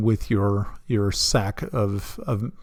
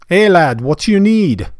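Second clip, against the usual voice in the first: attempted Scottish dwarf; 6 semitones higher, 9 dB louder than usual. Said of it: "here I go. It's really terrible".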